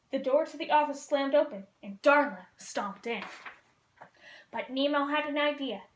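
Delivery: usual speaking voice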